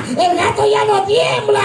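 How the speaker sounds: put-on a small kid jumping in with news; excited little boy. Son shouts proudly to his father, high excited child voice.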